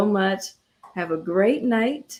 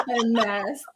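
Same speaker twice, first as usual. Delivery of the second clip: laughing; 4 semitones higher, 5 dB louder than usual. Real, not acted. Guest and host joking about distanced meeting, laughing. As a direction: talk through a laugh.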